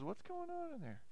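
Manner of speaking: high, quiet puzzled bystander; curious outsider. Pretend. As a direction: peer in and wonder what's inside. Outsider wondering at secret temple.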